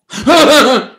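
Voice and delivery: high, breathy sinister laugh; evil whispered cackle. Acted. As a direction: cackle like the phantom voice. Ghostly voice ends its threat.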